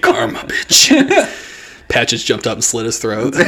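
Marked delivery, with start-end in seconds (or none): 0.0-0.9 hissed gloating whisper; whispered taunt. Retold or imitated. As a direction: whisper the taunt right in his face. Gloating to kid eye to eye.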